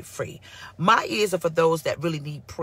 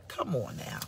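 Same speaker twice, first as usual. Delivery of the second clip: ordinary delivery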